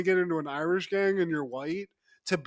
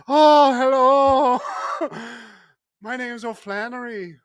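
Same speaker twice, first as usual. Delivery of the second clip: high, loud, hammed-up Irish greeting; Irish accent; 7 semitones higher, 7 dB louder than usual. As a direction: put on a jokey Irish accent. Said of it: "J C showing up there like".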